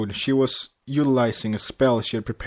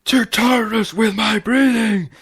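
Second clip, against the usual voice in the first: loud, high booming royal voice; wife roaring at her husband in the Royal Canterlot voice; 10 semitones higher, 6 dB louder than usual. A narrator's reading. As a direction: roar at him in a regal thunder. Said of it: "roared ... Royal Canterlot voice".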